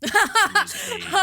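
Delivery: words broken up by a big high laugh; can't get the words out for laughing. Real, not acted. Laughing while describing her dad.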